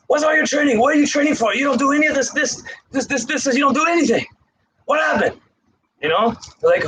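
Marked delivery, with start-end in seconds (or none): 0.1-5.4 a hint of a scolding sensei, higher; mock-scold the student, pitched up; stern sensei scolding. Sensei to struggling student, lightly imitated scolding. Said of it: "Sagashan's like".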